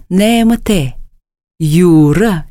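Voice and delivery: normal talking voice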